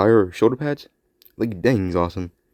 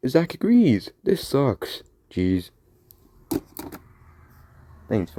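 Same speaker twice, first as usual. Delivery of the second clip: ordinary talk, nothing marked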